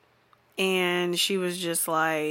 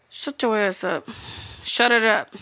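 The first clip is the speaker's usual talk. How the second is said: ordinary talk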